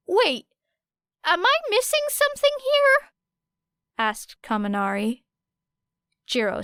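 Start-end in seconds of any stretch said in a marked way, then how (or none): 0.1-3.1 put-on much higher, bright-eyed confused boy; a puzzled teen boy cutting in, pitched way up; high, clueless young guy asking; Kaminari's voice. A narrator's reading.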